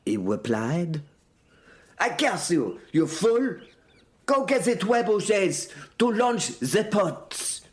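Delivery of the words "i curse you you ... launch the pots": hammed-up exasperated outburst, higher pitch; angered and piqued. A narrator's reading. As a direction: curse the fool in a piqued, raised voice. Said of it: "I was much angered and piqued".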